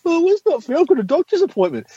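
high whiny mock voice; whiny protester. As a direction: whine it like a complaining protester. Mocking protester excuse.

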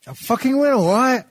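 high, loud defiant retort; defiant outburst. Real, not acted. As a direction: shout back, stung into it. Defiant reply, much higher.

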